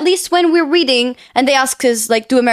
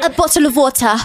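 British "bottle of water" with crisp t; put-on British accent; 3 semitones higher than usual. Shown as a demonstration. Mimicking British pronunciation, posh follows.

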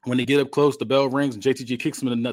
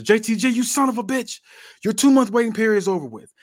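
high, loud angry yell; furious boss laying down the law, voice pushed up high; angry outburst; 11 semitones higher, 6 dB louder than usual. Acted. Fed-up boss confronts a wrestler over his waiting period, angry outburst.

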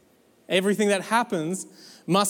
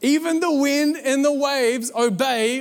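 high, drawn-out awestruck question; hammed-up frightened gasp of a question; terrified; 6 semitones higher, slower than usual. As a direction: ask it in high, slow, trembling awe. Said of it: "they were terrified and".

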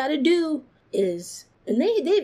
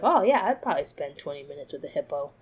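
casual breezy; 8 semitones lower, faster than usual. Retold or imitated. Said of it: "I was like".

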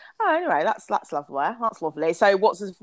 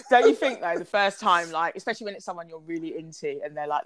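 ordinary delivery

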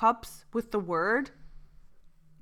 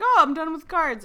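higher, much louder mimicked grandmother; mock announcement; 8 semitones higher, 11 dB louder than usual. Grandson mimics grandmother declaring she is out of cards, mock announcement. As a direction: pipe up loudly, imitating a triumphant card player.

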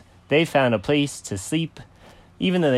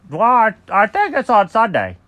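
high, loud, stammering character voice; flustered cat; 10 semitones higher, 7 dB louder than usual. Acted. Cat asked the day of the party, high flustered answer.

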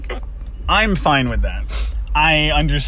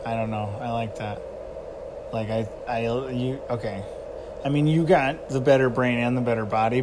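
usual speaking voice

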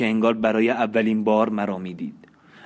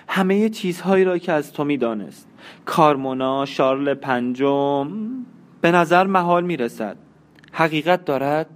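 a lightly raised woman's voice, cold and accusing; a hint of a horrified young wife; young wife confronting her husband, a touch higher; Marianne's voice; 6 semitones higher than usual.

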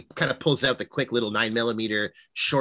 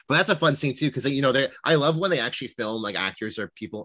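usual speaking voice

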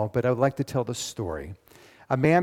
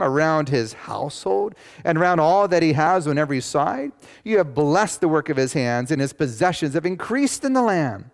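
loud, higher, driving preacher read; preaching scripture; 6 semitones higher, 6 dB louder than usual. Acted. Pastor booming out God's words to the congregation. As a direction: proclaim it to the congregation.

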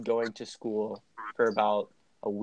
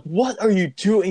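raised, higher mock yell; louder, high-pitched retold outburst; imitated yelling; 7 semitones higher, 5 dB louder than usual. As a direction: yell it like an outraged friend. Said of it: "He's, like, yelling and shit".

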